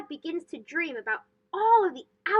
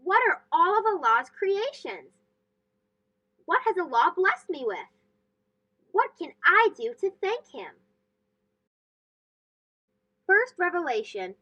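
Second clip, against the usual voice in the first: plain reading voice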